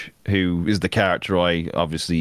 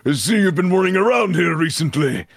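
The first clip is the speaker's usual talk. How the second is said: menacing zealot line, higher; 8 semitones higher than usual. Shown as a demonstration. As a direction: threaten like a zealot. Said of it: "we're gonna do some basic lines".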